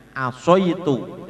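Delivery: slow "Asoitu" with rounded soi; fathah tebal. Shown as a demonstration. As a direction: draw out "Asoitu" with o in the middle. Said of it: "tidak asaitu tapi asoitu". ("Asoitu" pelan dengan soi tebal)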